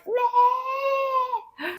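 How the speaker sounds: high cracked cry of pleasure; overwhelmed happy yelp; blissed-out groan. Pretend. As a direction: let out a dazed squeal of bliss. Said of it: "you're like".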